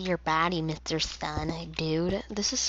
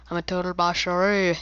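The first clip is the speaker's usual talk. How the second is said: put-on boast voice; 4 dB louder than usual. Shown as a demonstration. Repeats boast in new voice.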